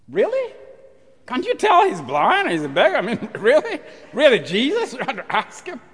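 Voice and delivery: high, loud disbelieving laugh; preacher teasing Jesus' question; incredulous. Real, not acted. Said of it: "preacher's incredulous laugh".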